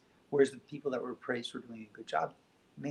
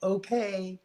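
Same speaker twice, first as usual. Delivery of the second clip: mom calling back; 8 semitones higher, 8 dB louder, slower than usual. Retold or imitated. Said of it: "she would yell back from her bedroom".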